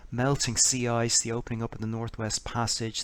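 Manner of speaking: usual speaking voice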